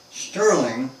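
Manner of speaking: loud start of a protest. Acted. Annie about to argue with Sterling, loud protest. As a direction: cut in to argue with him.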